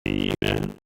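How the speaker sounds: garbled robotic audio; scrambled voice. Real, not acted. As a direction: voice breaking up through a computer. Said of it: "like you were talking through a computerized voice".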